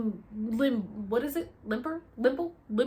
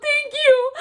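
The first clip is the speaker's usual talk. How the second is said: overjoyed thanks; 13 dB louder than usual. Retold or imitated. Grateful cry on the grass.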